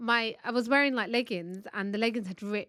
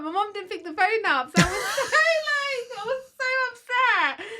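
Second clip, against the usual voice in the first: much higher, half-laughing, half-crying; laughing while upset; 12 semitones higher, 6 dB louder than usual. Real, not acted. Wife retelling her mum not answering, teary laughing. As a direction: laugh through the memory of being upset.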